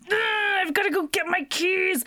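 high, hammed-up panicked wail; freaking-out Raz. Man whose keys got chucked in the lake, mock freak-out. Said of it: "Raz freaks out and he's like".

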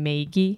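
"Maggie" said as MAY-ghee; Wisconsin pronunciation. Shown as a demonstration. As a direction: say "Maggie" with a long ay. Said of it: "how everyone in high school said".

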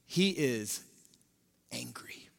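dramatic whisper. Acted. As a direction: drop to a whispered hush. Dramatic hush after build.

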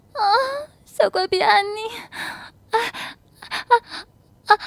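very high, gasping ecstatic cries; high, sobbing moans rising in volume; unrestrained moaning cries. A narrator's reading. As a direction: let the cries build without restraint. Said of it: "小嘴儿也放肆地浪叫".

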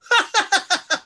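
high-pitched sinister cackle. Acted. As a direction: let out a villain's laugh. Cackle after doom speech.

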